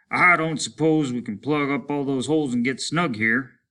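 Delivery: bitter grim dialogue; lightly voiced weary soldier. Soldiers in the ruined dome.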